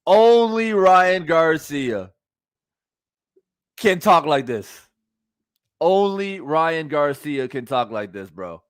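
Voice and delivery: drawn-out, slow exclamation of disbelief; amazed disbelief. Real, not acted. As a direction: stretch the words out, marvelling. Exasperated reaction to the clip.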